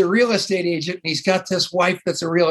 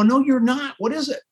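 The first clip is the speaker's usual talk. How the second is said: pitched way up, insisting; pushy excitement; 9 semitones higher than usual. Retold or imitated. Eager caller cutting off the ad man, pitched high and pushy. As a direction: refuse the brush-off, pitched high and demanding. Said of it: "I'm crazy".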